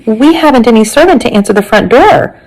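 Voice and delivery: usual speaking voice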